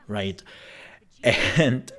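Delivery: normal talking voice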